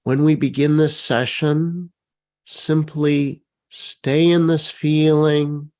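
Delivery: gentle session guide; guided meditation. Acted. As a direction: lead them through the movement calmly. Leads the movement meditation.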